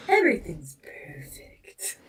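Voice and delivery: breathy unvoiced whisper for the cat; soft whisper, as the cat. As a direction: hush it like a smug cat. Cat speaking, voiced in whisper.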